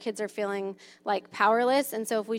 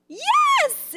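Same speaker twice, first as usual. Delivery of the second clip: squeaky high "Yes", much higher and louder; really high voice; 6 dB louder than usual. Shown as a demonstration. Mom shows the high "Yes" game to a grumpy child, very high. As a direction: pitch the "Yes" way up. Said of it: "in a really high voice".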